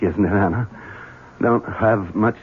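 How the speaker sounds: usual speaking voice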